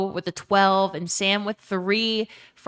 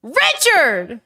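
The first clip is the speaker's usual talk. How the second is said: loud excited scream; 8 semitones higher, 8 dB louder than usual. Real, not acted. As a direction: yell the name out. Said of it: "A scream is valid".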